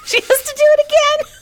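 high, fast mock despair; mock anguished wail. Mock dramatic wail about dying.

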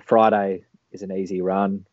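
usual speaking voice

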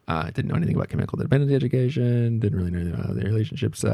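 ordinary talk

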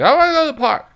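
put-on high, loud little-girl voice; toddler voice. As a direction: play the two-year-old demanding the park.

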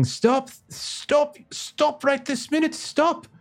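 put-on very high shouted French accent; French policeman shouting. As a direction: yell like a frantic French cop. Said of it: "the policeman kind of shouting".

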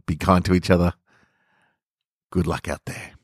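usual speaking voice

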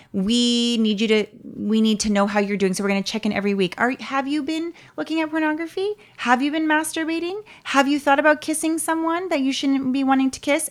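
high, prying mock elder; prying church leader. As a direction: quiz them like a nosy elder. Leaders quizzing about sins weekly.

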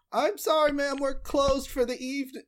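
put-on high, polite boy from the kitchen; young boy voice. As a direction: play a nervous teenage clerk. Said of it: "the young boy pop his head out".

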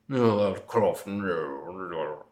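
low, trailing-off hammed-up impression; Alan Rickman. Shown as a demonstration. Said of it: "that's how he does".

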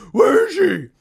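forced, high-strained mock interrogation; Batman. Batman interrogating the Joker, mocked strained voice. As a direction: demand it in a put-on superhero voice. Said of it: "he's trying to get him to go".

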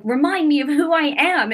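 high, insistent plea, reenacted; retold upset demand. Wife asking husband to remind her, retold upset. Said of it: "I was crying, but I was mad".

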